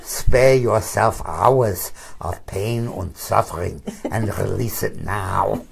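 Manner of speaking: put-on slow German accent through laughter; a laughing, hammed-up German accent; mock German accent. Urging the counsel to release now, mock German accent. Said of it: "put on my best German accent".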